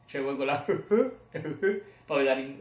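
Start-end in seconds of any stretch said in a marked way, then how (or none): 0.6-1.8 high dopey cartoon chuckle; Goofy laugh. Showing Pippo's laugh, goofy chuckle. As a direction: chuckle like a daft cartoon dog. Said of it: "so fare solo la sua risata".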